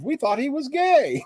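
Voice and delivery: high, slow, laughing mock family. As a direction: play the relieved parents, high and slow. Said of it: "mocking the family's relief".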